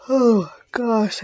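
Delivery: words stretched through a real yawn; fighting back a yawn mid-sentence. Real, not acted. Teacher yawning during a math lesson, yawning. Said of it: "I can't stop the yawns right now".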